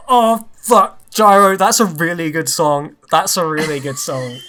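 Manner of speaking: mock-pained compliment, straining to sound sincere; pained fake praise. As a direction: gush about the song through gritted teeth. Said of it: "he looks in pain".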